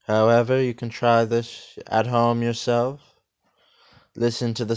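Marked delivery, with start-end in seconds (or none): none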